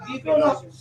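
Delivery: usual speaking voice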